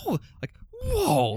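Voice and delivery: high, slow, stunned whoa; drawn-out amazed gasp; awed whoa. Retold or imitated. Awed reaction to film scene.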